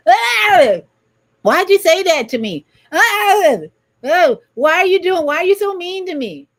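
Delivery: high, loud wounded whine, cries of hurt; whiny hurt inner child. Retold or imitated. Host mocking a triggered inner child, high loud whine. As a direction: squeal like a triggered child taking offense. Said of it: "Oh, that's your inner child".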